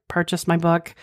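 normal talking voice

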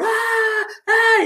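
high-pitched imitated yell of pain; retold scream. Obese patient screaming through the hospital, high pained yell. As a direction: mimic a man screaming in pain down the corridor. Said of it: "E ele passou gritando".

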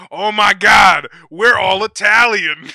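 high, very loud, drawn-out mock astonishment; hammed-up shout. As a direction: holler it like a whole shocked club. Acting out whole club's reaction.

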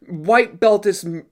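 normal talking voice

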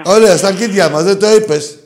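fast scornful shout at the caller; mocking shout. Real, not acted. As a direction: blast it at the caller, rushing. Host yells mockingly at Larisa caller.

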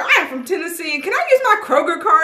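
eager announcement. Retold or imitated. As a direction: proudly tell the cashier where you're from. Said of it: "Made sure I told the cashier".

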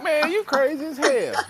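high, loud incredulous squeal; mocking disbelief. Friend telling him quitting is crazy, in a high, loud incredulous voice. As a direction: scoff at the idea like a skeptical friend.

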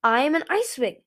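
firm. A narrator's reading. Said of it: "he said again firmly".

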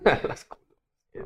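amused laugh through the words; laughing repeat. Real, not acted. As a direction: laugh as you repeat the word back. Laughing while repeating the phrase.

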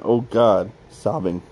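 ordinary delivery, nothing marked

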